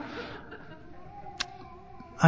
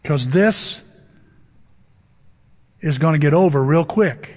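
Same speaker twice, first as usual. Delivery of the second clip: calming Moses; 5 semitones lower than usual. Retold or imitated. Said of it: "a group of believers going".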